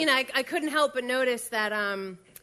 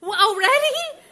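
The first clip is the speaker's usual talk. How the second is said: surprised; 12 semitones higher, 8 dB louder than usual. Real, not acted. Surprise at promotion.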